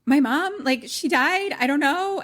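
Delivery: high, loud imitation of panic; freaking out. Leah tells the operator her mom died, freaking out. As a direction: act out a panicked kid on the phone. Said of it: "she's, like, freaking out".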